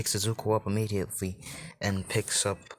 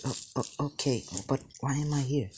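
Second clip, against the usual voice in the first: put-on higher, hesitant child-like question; timid stammer; 5 semitones higher than usual. Frightened boy asks stranger why he is here, timid higher voice. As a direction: stumble shyly into a nervous question.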